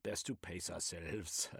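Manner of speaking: put-on Reich in a German accent, eagerly expounding. Reich speaks, German-accented figure.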